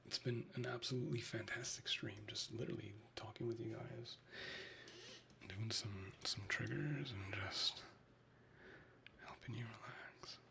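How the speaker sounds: soft breathy whisper to the chat; ASMR whisper. Real, not acted. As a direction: murmur it close to the mic like an ASMR stream.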